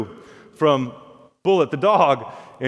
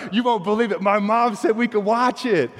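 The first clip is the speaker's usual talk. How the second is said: put-on higher, amazed boy voice; a kid bursting with news, higher; excited kid; 5 semitones higher than usual. Excited boy tells friend.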